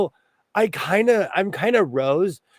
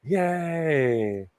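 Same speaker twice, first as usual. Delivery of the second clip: normal talking voice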